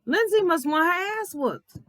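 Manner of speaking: high, put-on voice of irritation; high sassy mock threat; mock exasperated threat. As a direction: play up the annoyance, sassy. Retelling her own irritated thought.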